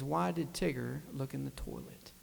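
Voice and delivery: hint at the friend's hushed voice; retold whisper. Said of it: "And he whispers again".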